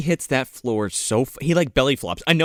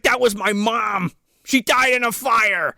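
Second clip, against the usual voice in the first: mock outcry; 8 semitones higher than usual. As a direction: imitate someone wailing a grievance, hammed up. Acted outraged retort in retelling.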